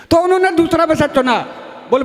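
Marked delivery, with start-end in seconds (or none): none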